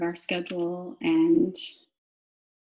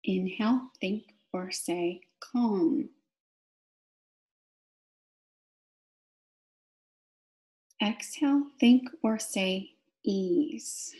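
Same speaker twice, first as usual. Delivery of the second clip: guided meditation. Real, not acted. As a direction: draw out each cue word, pausing for the breath. Guides breathing with key words.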